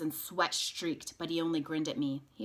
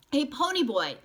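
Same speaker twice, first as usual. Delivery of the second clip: calling out; 9 semitones higher, 6 dB louder than usual. A narrator's reading. Calling out in church.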